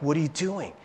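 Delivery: mock a busy sister scolding the lazy one; scolding Martha type. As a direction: Martha type telling Mary to get moving. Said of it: "we're like".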